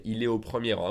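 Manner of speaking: quick, est au said without the T; fast, no liaison. Shown as a demonstration. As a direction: rattle it off fast. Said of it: "If I said faster".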